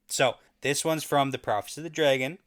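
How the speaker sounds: normal talking voice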